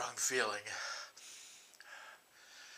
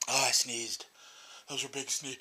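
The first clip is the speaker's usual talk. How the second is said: ordinary talk, nothing marked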